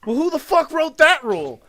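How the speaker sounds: very high, loud outraged shriek; mother yelling at the agent, shrieking; furious demand. Retold or imitated. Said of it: "And she's like".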